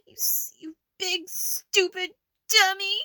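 tearful high shout; crying shout. Acted. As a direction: wail the insults through tears. Catgirl crying and shouting insults.

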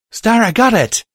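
high, loud, excited shout; excited call. Acted. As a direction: call out the good news with joy. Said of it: "Violet called".

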